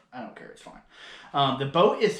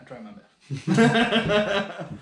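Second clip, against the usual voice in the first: slow, laughing self-deprecation; laughing bashfully; 6 semitones higher, 3 dB louder, much slower than usual. Real, not acted. Laughing at being thanked for the boat. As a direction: laugh it off, a bit higher.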